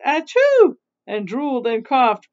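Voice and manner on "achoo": loud, high fake achoo; acted sneeze. Mother Witch sneezing, played loud. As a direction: burst out a mock sneeze. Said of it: "She sneezed".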